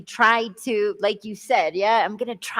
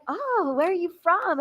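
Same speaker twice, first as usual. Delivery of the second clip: curious quote; 8 semitones higher than usual. Retold or imitated. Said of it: "they're like".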